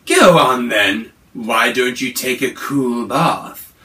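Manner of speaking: loud, slow, gloating monster voice; greedy monster. Acted. The greedy Yowie agreeing to wait for mummy, loud and gloating. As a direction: savour the thought of a bigger meal. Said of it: "The Yowie was greedy".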